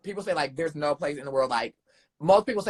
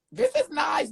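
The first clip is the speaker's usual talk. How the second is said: high mock squeal; 13 semitones higher, slower than usual. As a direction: squeal it like a fake-thrilled fan. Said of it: "it was like".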